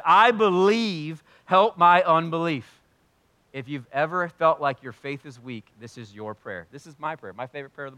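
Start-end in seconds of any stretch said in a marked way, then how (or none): 0.0-2.8 slow, loud, high-pitched plea; father crying out to Jesus, slow and loud. Retold or imitated. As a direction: cry it out desperately. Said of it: "cried out and he said".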